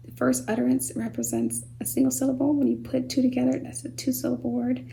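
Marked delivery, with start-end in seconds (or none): none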